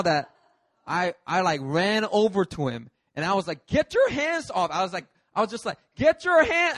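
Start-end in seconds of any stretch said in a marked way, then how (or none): 3.7-4.7 high, drawn-out protective yell; retold indignant shout, slowed down; angry intervention. Rushing bouncer who grabbed friend. 6.0-6.8 high, loud retold yell; mock-furious, pitched way up; angry intervention. Repeating his yell before the punch.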